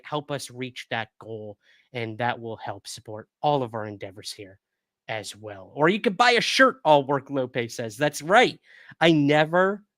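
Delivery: usual speaking voice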